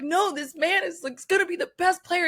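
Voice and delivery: high-pitched mock insistence; insistent retold gush. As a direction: insist he's the star, voice way up. Retelling own insistence to scout.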